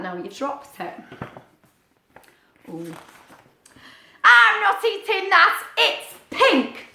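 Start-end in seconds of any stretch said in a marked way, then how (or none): 4.2-6.9 high, loud refusing duck voice; fussy duck character; stubborn duck. Acted. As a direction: flatly refuse the soup. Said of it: "said Duck".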